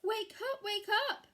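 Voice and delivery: high, loud urgent bark; barked wake up. A narrator's reading. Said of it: "barked Woody".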